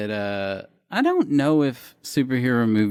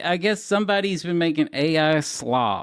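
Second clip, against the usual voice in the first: usual speaking voice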